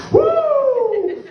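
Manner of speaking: long, high-pitched whoop; wired whoop. Retold or imitated. Man wired from his drink, long high whoop. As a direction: let out a sustained high woo.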